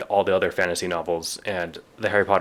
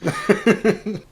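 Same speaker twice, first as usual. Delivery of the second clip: laughing through quote; 9 semitones higher than usual. Acted. Laughing while quoting Harry.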